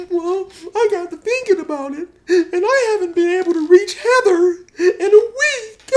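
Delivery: exaggerated weepy falsetto; [name]. [name] missing Heather, hammed-up falsetto sobbing. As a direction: squeak it out between mock sobs. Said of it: "[name] is crying and stuff".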